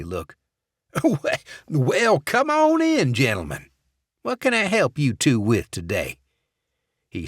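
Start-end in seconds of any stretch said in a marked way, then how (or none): 0.9-1.6 high nervous laugh; forced laugh. Acted. Eddie covers his anger. 1.7-6.2 much higher, louder forced welcome; fake welcoming boss. Eddie suddenly fake-welcoming.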